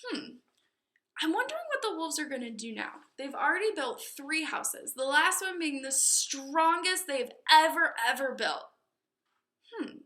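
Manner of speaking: plain reading voice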